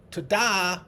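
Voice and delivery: high, loud showman's ta-da; magician's ta-da. Pretend. Hand pointing at the learner, magician's triumphant ta-da. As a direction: pop it out like the big reveal of a trick. Magician figure in memory picture.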